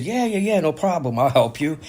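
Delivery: high, quick yes-yes-yes, overly keen; eager to please. Retold or imitated. Young guy eagerly agrees to help the older man, high-pitched. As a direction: jump in to help, voice shooting up high.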